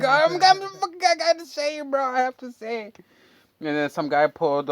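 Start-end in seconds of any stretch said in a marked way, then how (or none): 0.0-3.0 fast, high and pumped, playing it up; hyped bragging. Telling Michael he has to film it, fast high hype. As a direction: rattle it off high and fast like a show-off. Bragging about being the YouTuber.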